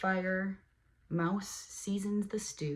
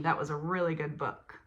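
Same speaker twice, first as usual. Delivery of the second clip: ordinary delivery, nothing marked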